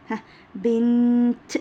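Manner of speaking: teacher demo of بنت, final ت clear; slow demo word. Shown as a demonstration. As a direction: stretch بنت and land firmly on the ت. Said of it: "أنطقها عند الوقف".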